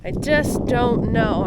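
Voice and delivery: usual speaking voice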